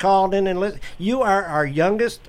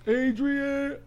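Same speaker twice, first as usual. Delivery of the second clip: drawn-out, higher mock movie call; Rocky Balboa; 6 semitones higher, 7 dB quieter than usual. Co-host quoting the Rocky movie, mock Rocky call. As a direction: call out the famous movie line, softly stretched. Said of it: "That was Rocky".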